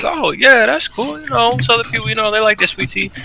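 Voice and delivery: high-pitched, agreeable retail chirp; polite customer-service agreement. Retold or imitated. Acting polite reply to customer.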